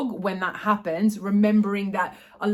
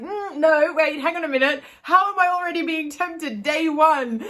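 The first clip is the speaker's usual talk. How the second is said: catching herself; 6 semitones higher, 3 dB louder than usual. Retold or imitated. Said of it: "I was like".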